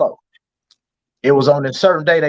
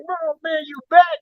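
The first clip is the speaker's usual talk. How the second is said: imitating his friend; 13 semitones higher than usual. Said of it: "He be like".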